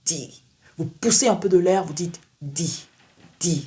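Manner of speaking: D behind the upper teeth with a push of air; English D, puff of air behind the teeth; D anglais avec un souffle. Shown as a demonstration. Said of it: "plutôt à l'arrière des dents supérieures".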